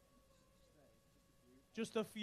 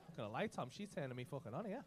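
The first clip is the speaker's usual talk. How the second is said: mock flirty aside; 9 semitones lower, 8 dB quieter than usual.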